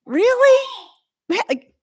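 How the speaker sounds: very high, loud disbelieving question; incredulous. Real, not acted. Incredulous at constant corrections.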